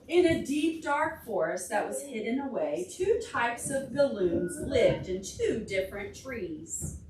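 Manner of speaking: lively read-aloud to a class; story reading for children. Acted. Teacher reading a picture book to the class, lively storytime voice.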